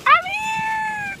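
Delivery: high, loud arrival cry; excited shout. Real, not acted. Arriving at Target, shouting to viewers. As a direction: squeal the arrival.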